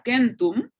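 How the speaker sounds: centum with a hard K sound, kentum; classical Latin centum. Shown as a demonstration. Teacher demonstrating classical Latin: centum with hard K. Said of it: "la C suena como K en la clásica".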